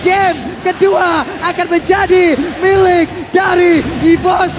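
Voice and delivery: slow, loud, drawn-out finale call; fighting game announcer. Acted. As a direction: announce the game's winner with weight. Caster calling the game's end.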